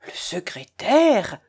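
slow, drawn-out astonished cry; exclamation stupéfaite. A narrator's reading. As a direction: stretch SECRÉTAIRE out in disbelief. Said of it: "s'écria le curé". (stupéfaction traînante du curé)

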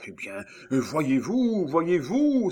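alarmed exclamation climbing high and loud; trembling cry. A narrator's reading. Miton panicking to his companion, trembling cry. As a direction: build to a frightened cry. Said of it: "s'écria Miton tremblant".

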